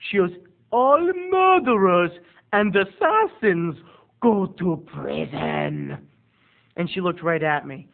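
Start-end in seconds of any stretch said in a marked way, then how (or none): 0.7-6.1 slow, drawn-out decree starting high and loud; theatrical stretched words, falling to the end; imitated eccentric aunt. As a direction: pronounce judgment in the odd aunt voice. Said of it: "she talks like this".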